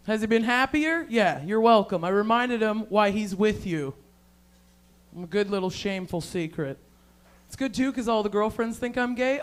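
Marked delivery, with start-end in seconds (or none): none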